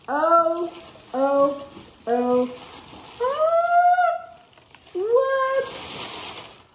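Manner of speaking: loud high cries of amazed delight; overwhelmed, squealing in happy disbelief; thrilled squealing. Real, not acted. Unwrapping the awaited bag, thrilled.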